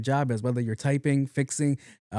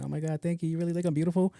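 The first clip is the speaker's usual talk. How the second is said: pretend flattered; 5 semitones higher, 3 dB quieter, faster than usual. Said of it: "I'm pretty sure she's pretending".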